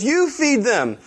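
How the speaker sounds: high-pitched acted comeback; mock exasperated retort. [name] answers John on the mercy trip, mock exasperated retort.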